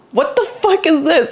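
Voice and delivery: high, stunned retold exclamation; shocked outburst. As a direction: react like you can't believe the pile. Seeing twenty boxes.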